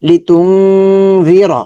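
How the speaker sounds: তুংজি chanted slowly with long nasal hum; Quran recitation. Shown as a demonstration. Teacher demonstrating the heavy র, slow chant. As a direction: stretch তুংজি র in measured tajweed. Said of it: "মাটা এখানে লম্বা হবে".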